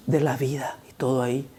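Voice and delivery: whispered in awe; hushed whisper. Acted. Preacher hushing the congregation with a whisper. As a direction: drop to a whisper. Awed hush in vision.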